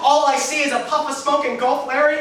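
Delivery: ordinary talk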